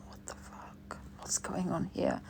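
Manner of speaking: very soft, whispery alarm; hushed retold disbelief. Retelling seeing a clown outside the window, hushed disbelief. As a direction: whisper it to yourself, baffled. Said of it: "So I'm like".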